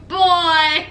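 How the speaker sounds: imitating a man cracking up; laughing. Boyfriend laughing at girlfriend's ultimatum, imitated laugh. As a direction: say it, then burst out laughing. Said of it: "So he starts laughing".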